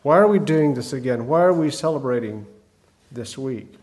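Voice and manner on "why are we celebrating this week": usual speaking voice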